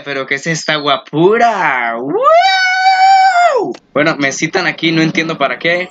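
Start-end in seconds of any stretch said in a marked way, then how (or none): 2.0-3.7 very high, loud wow; excited shout. Real, not acted. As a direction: whoop in delight. Sees an attractive character.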